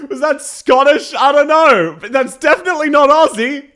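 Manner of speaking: high, loud, incredulous outburst; loud disbelief. Real, not acted. Streamer baffled by a character's accent, loud incredulous outburst. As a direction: exclaim it high, on the edge of laughter.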